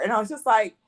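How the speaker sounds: ordinary talk, nothing marked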